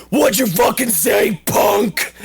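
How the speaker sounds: gruff bully. Retold or imitated. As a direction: snarl it like a thug picking a fight. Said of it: "And then Barrett goes".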